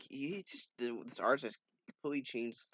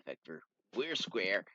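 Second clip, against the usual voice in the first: usual speaking voice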